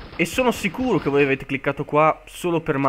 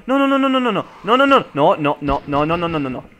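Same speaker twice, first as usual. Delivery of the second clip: panicked no no no; 3 semitones higher, 5 dB louder than usual. Real, not acted. Mobs chasing him in game.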